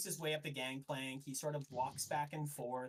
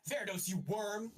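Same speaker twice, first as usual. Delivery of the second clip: louder, higher outburst of contempt; angry captain; 8 semitones higher, 5 dB louder than usual. Acted. Siona confronts the inquisitor as blades come out, angry outburst. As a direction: spit the insult at your enemy. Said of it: "you hear Siona go".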